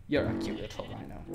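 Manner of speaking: low soft cooing to a pet. Real, not acted. Cooing at baby rhino.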